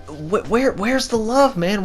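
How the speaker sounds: ordinary talk, nothing marked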